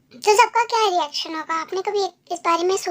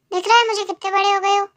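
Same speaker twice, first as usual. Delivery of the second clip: ordinary talk, nothing marked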